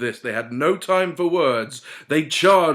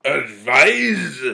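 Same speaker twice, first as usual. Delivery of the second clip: beery drawl; 7 dB louder than usual. A narrator's reading. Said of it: "in a beery drawl".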